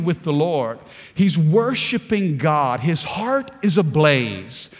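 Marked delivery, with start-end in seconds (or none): none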